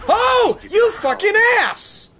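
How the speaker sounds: high, loud furious yell; angry gamer shout. Real, not acted. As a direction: scream at the betrayer on screen. Gamer betrayed by an enemy.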